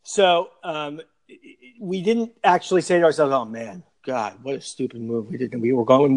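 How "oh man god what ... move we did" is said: mock regret. Mocking fans' regret, low quiet.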